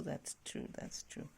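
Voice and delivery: whispered under the breath; a soft whispered aside. Real, not acted. Parent whispering agreement to the child after her answer.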